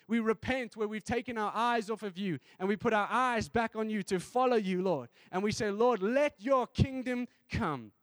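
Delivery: loud, high, building fervent preaching; preaching at full force. Acted. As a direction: rise into a passionate shouted sermon.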